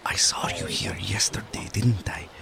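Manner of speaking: whispering in your ear. Acted. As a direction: close soft whisper at the ear. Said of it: "The second voice will be whispering in your ear".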